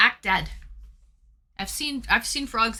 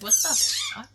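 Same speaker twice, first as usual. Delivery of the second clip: shocked outburst; faster than usual. Retold or imitated. Retelling how Suki reacted to the frog, high mock shriek. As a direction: shriek it like a startled friend. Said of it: "Suki came in and was like".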